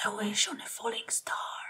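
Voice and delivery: soft breathy whisper to the sky; whispered wish. A narrator's reading. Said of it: "he whispered".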